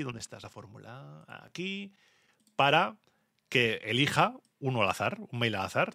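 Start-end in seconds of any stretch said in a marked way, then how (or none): 0.0-1.9 muttering to himself. Real, not acted. Searching the spreadsheet mid-show.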